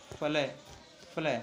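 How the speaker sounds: फलै repeated as a short "falae"; the mistaken फलै, short "falae"; wrong pronunciation. Shown as a demonstration. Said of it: "तो ऐसे नहीं पढ़ना".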